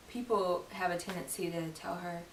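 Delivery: normal talking voice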